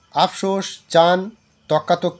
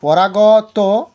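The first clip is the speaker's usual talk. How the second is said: পরাগত drawn out very slowly and louder, each syllable held; teacher dictating পরাগত slowly and firmly; slow teacher emphasis; 2 semitones higher, 5 dB louder, much slower than usual. Shown as a demonstration. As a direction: stretch পরাগত out slowly for the class. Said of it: "এই তর্কাতর্কি হচ্ছে গিয়ে".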